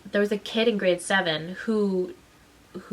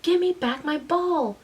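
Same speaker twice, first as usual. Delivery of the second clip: high-pitched insistent demand; angry; 8 semitones higher than usual. Retold or imitated. Little kid demanding her ball from a grade seven, angry. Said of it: "And I was angry".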